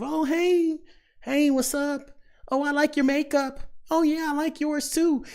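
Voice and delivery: high, sugary fake-friendly girl voice; fake-nice woman. Woman greeting a rival she secretly dislikes, high fake-sweet voice. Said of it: "they'll do the passive aggressive".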